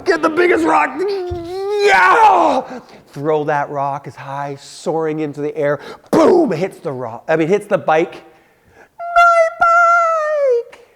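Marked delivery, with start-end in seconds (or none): 0.0-2.8 high, loud triumphant yell; excited shout. Retold or imitated. Retelling his angry revenge. 8.9-10.9 very high, loud mock wail of a girl. Girl sees her bent bike.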